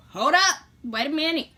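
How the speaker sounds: alarmed shout. Retold or imitated. Telling her boyfriend to stop, loud and alarmed. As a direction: stop him sharply, half yelling. Retold alarm during the encounter.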